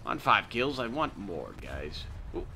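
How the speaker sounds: usual speaking voice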